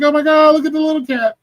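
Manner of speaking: very high cooing over a kitten; high, cooing kitten talk; cutesy baby talk. Retold or imitated. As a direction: squeal it like baby talk to a kitty. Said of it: "I was like".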